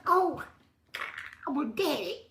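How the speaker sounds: high, overjoyed outburst; thrilled. Retold or imitated. Retelling his joy at becoming a dad, high and thrilled. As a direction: squeal it like a new dad.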